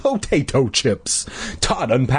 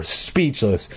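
plain reading voice

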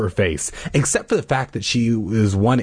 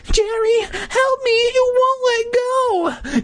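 panicked pleading; slower than usual. Acted. Trapped sister begs for help.

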